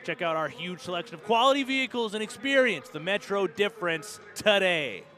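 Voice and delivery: high, loud, fast ad pitch; live ad read. Shown as a demonstration. Announcer reading a Metro Ford live ad, hyped. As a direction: sell it like a car commercial. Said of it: "my emphasis on the word today".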